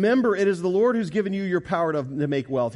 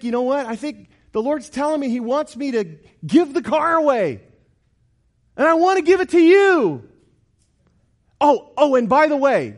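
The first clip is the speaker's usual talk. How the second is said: higher, louder, bubbling excitement; excited friend; 10 semitones higher, 8 dB louder than usual. Retold or imitated. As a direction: retell the friend's joy as it builds. Friend excitedly giving car away.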